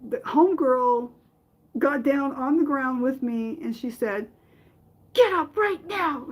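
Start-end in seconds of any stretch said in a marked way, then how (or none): 5.1-6.3 high, sharp mock yell from mom; a mother's high mock yell at her crying daughter. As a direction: bark it like a fed-up mother. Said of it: "She yelled at me".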